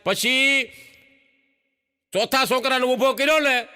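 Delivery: usual speaking voice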